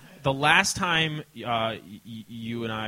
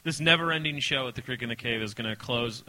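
normal talking voice

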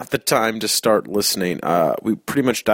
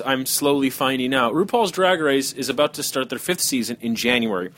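normal talking voice

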